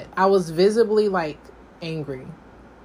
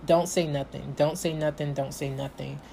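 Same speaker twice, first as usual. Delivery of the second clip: low, soft muttering to oneself, fading away; restrained self-talk; 5 semitones lower, 7 dB quieter than usual. Retold or imitated. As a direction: talk yourself down under your breath. Retold self-talk to keep cool.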